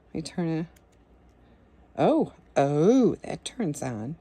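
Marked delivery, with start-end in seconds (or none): none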